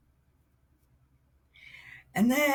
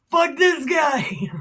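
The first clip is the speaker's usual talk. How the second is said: high-pitched, drawn-out defiant shout; hammed-up cocky dismissal of an enemy; mock bravado; 8 semitones higher, 3 dB louder than usual. David dismisses Goliath, acted.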